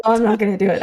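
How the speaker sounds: ordinary delivery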